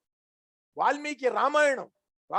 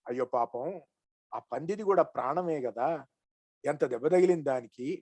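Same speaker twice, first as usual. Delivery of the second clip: pitying murmur; 9 semitones lower, 6 dB quieter than usual. Acted. Sage pities wounded boar.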